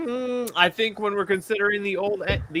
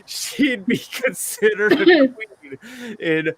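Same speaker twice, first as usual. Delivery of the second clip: story narration; 7 semitones lower, slower than usual. Acted. Reads fantasy story aloud.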